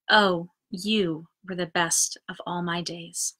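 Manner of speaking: unhurried recitation with lingering pauses; poetry reading. Acted. Reading Frank O'Hara's poem Animals to viewers, gently recited. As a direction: read it like a gift to the listener.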